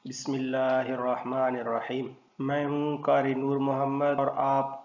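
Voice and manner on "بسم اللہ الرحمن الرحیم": measured, chanted recitation with Arabic articulation; Quranic recitation. Acted. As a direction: recite the opening formulas devoutly. Opening Quran recitation formula. (تلاوت کے انداز میں)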